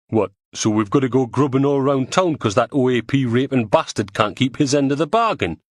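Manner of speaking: indignant Geordie grumble; a lightly voiced Geordie rant; Geordie man voice. Neil rants in the back.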